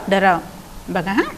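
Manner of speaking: normal talking voice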